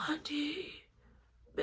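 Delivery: high, faint and airy plea; slurred, quiet calling. Retold or imitated. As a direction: call for help with almost no voice. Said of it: "Like, that's literally me".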